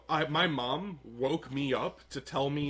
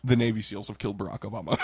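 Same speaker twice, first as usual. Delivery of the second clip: quick deadpan; 6 semitones lower, faster than usual. Retold or imitated. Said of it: "being told".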